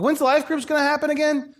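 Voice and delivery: pitched well up, eager mock question; eager asking. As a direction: impersonate keen members begging for it.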